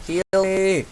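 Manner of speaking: calling out for healing; man down shout. Real, not acted. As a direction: shout to the squad. Calling teammates for help.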